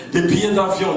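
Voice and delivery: normal talking voice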